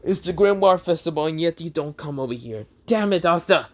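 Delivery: angry yell building to a high scream; scold, then scream the name out; high, loud, exasperated shouting. A narrator's reading. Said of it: "He screams in this".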